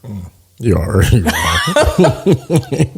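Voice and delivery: teasing through laughter; laughing. Real, not acted. Teasing her low guess.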